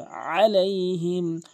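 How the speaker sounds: আলাইহিম slow, আইন from the throat; correct Alaihim. Shown as a demonstration. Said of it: "এখানে আইন উচ্চারণ করতে হবে". (শুদ্ধ, আইন দিয়ে)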